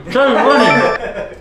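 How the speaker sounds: slow, mushy mouthful; mouth full. Real, not acted. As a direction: force it out through stuffed cheeks. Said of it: "you gotta say".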